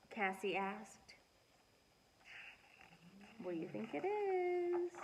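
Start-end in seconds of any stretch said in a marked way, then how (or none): 3.4-4.9 higher, playful question; lightly voiced playful question; teasing guess. A narrator's reading. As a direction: tease the kids. Dog's imagined reply.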